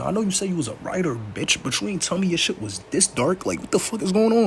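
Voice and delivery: rising into a high, disbelieving what the fuck; shocked disbelief. Real, not acted. Shocked reaction to dark poem.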